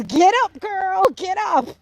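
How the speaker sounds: high, stretched-out command; imitated rousing call. Quoting the video's command again.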